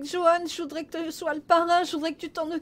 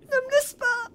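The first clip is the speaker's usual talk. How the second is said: tearful pleading; 5 semitones higher, 7 dB louder than usual. Acted. Begging dying friend to stay.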